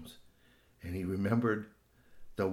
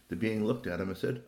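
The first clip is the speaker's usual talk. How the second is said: usual speaking voice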